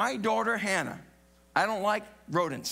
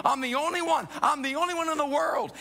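rising lament; 8 semitones higher than usual. Pretend. Voicing lonely sufferer's lament.

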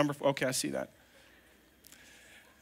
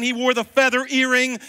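usual speaking voice

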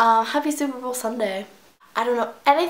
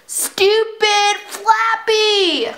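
high, loud, drawn-out scolding; exasperated yell; 10 semitones higher, 6 dB louder, much slower than usual. Real, not acted. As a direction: yell slowly at the bird. Scolding the bird after losing.

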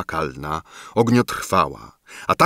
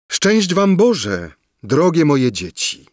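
slow, higher, tender fairy blessing; heartfelt blessing; 7 semitones higher, 3 dB louder than usual. A narrator's reading. Fairy blesses the young couple, slow and tender. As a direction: bless them slowly and fondly. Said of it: "powiedziała serdecznie".

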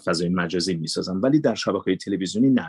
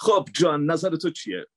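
higher, bright presenter read of a music show; dubbed show host voice; 8 semitones higher than usual. Shown as a demonstration. Voice actor demos his dubbed music host, presenter voice.

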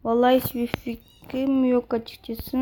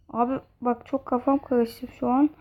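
ordinary delivery

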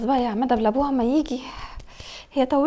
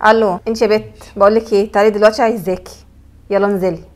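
bossy summons; 3 semitones lower, 7 dB louder than usual. Acted. Stepmother summoning girl harshly.

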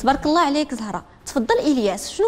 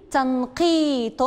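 slow model reading; much slower than usual. Shown as a demonstration. Teacher models تنقيط for pupils to repeat, slow and drawn out. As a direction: draw تنقيط out slowly, syllable by syllable. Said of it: "رددوا من بعدي".

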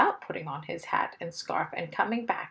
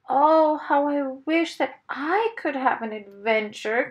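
loud, higher wistful wail, lightly acted; self-pitying cry; 7 semitones higher, 5 dB louder than usual. A narrator's reading. Mister Wumble longing for an adventure, self-pitying cry. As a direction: cry out a wish with a touch of self-pity. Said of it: "feeling sorry for himself".

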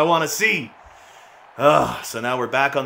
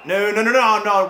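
frantic no; 8 semitones higher, 5 dB louder than usual. Real, not acted. Goal blunder in game.

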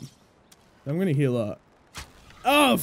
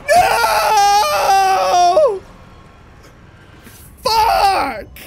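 loud high cry of despair; crying out while falling into the lava; anguished shout; 7 semitones higher, 5 dB louder, much slower than usual. Real, not acted. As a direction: wail no as you fall. Dying in lava.

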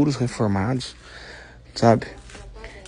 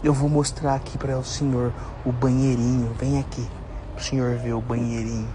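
hushed, lowered voice; library whisper; 2 semitones lower, 10 dB quieter than usual. Real, not acted. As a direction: keep it down so grandpa isn't bothered. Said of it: "Fala mais baixo".

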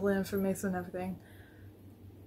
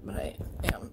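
much softer, a breathy throwaway; whispered aside; 7 dB quieter than usual. Real, not acted. Vlogger admits oversharing to viewers, whispering.

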